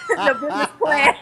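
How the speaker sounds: high-pitched, laughing through the words. Real, not acted. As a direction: crack up while saying it. Laughing at half-formed film ideas.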